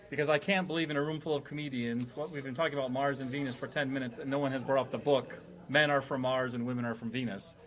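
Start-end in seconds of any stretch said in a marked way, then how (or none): none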